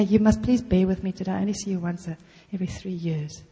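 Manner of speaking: ordinary talk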